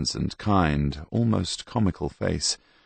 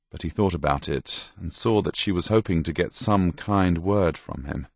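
usual speaking voice

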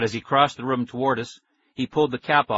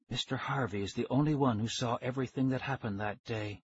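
hushed scientist; 7 dB quieter than usual. A narrator's reading. Scientist reveals witness to ghost children, hushed. Voices the scientist Doctor Smollett.

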